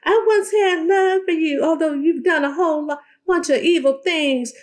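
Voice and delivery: high, teary put-on voice, imitating; a hammed-up tearful high tone, mocking; mock Tisha. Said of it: "and Tisha's like".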